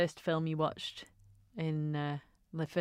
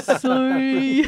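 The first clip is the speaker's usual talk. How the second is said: loud, drawn-out slow-motion call; mock slow motion; 9 semitones higher, 13 dB louder than usual. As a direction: stretch every word out, high and loud. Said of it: "they do it in slow motion".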